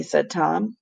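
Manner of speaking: ordinary delivery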